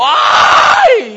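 high, very loud howl of pain; comic agonised shriek; yelp of pain. Retold or imitated. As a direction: scream as if jabbed, hammed up. Acts out sudden pain when sitting.

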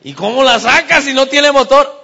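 high, loud exasperated question; acted-out baffled outburst; incredulous yell. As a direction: shout it in comic disbelief.

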